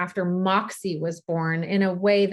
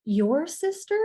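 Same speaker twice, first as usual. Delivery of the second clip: gentle surprised question; 7 semitones higher, slower than usual. Retold or imitated. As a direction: ask back gently in wonder. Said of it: "I said".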